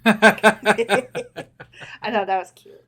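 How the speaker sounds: laughing through the words. Real, not acted. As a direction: chuckle while saying it.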